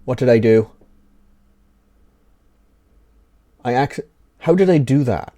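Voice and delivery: curse, then ask in disbelief what just happened; genuinely rattled, baffled exclamation; real startled dismay. Real, not acted. Sudden mishap with the monitor.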